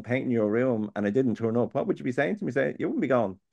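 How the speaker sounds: ordinary talk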